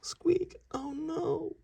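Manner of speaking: very high squeaky alarmed cry; squeaky mouse yell. Acted. Mouse seeing the broken tusk, a high squeaky alarm. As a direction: shriek in a tiny mouse voice. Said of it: "Mister Mouse yelled".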